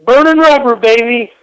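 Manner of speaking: loud, high holler on request; yelled catchphrase. Pretend. Guest yelling the catchphrase to get off the radio. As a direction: belt out the show's slogan. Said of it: "you gotta yell those three famous words".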